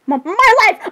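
high, exasperated wail; shocked exclamation. Pretend. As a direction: cry out in mock disbelief. Shocked outburst over remark about Ariel.

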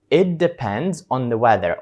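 slow repeat. Shown as a demonstration. Teacher repeats the answer for students. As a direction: repeat it plainly for copying. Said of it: "І ви відповідаєте".

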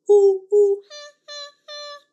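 loud ooh ooh then high ha ha; monkey hoots. Acted. Teacher doing monkey calls for toddlers. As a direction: hoot like a playful monkey. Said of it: "What does a monkey say?".

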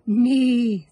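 loud whinnying neigh; big loud horse whinny. Acted. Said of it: "said the horse".

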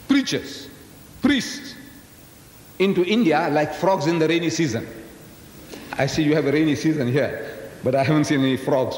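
usual speaking voice

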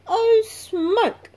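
high, loud little caboose sighing in disgust; a small train car grumbling, high and loud; little caboose's voice. A narrator's reading. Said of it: "said the little red caboose".

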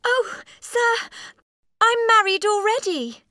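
very high, loud confession; young woman's high, anguished outburst; wailing cry. A narrator's reading. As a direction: wail it out in distress. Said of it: "she cried again".

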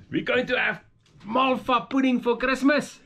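high, thrilled reveal; excited announcement. Real, not acted. Telling viewers about the Christmas pudding, high and excited.

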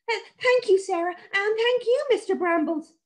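very high, stammering shaky thanks; a shaken boy thanking his rescuers; shivering thanks. A narrator's reading.

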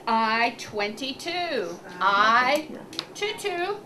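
clear, measured number calling; bingo caller. Acted. Calls bingo numbers to clients.

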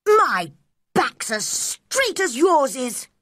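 high, slow, emphatic protest; indignant boy's shout. A narrator's reading. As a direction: shout it back as an offended boy. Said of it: "he shouted".

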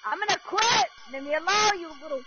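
frustrated outburst. Real, not acted. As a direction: blurt it out like you're done with this game. Gets angry at other players.

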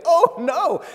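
put-on high, loud old lady wail of dismay. Elderly lady seeing her parakeet vanish, high dismayed cry. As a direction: squeal it like a horrified elderly lady.

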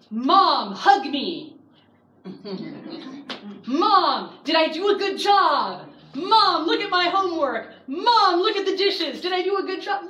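put-on high, loud, eager kid's voice begging for praise; eager little boy. Felix pestering his mom for praise in a high, eager child's voice. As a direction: play an excited eight-year-old wanting approval. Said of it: "who lately has been coming up going".

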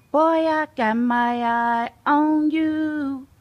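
slow, loud mock threat; mock warning. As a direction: pretend to keep a watchful eye on him. Warning the approaching mailman.

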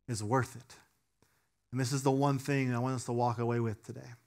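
usual speaking voice

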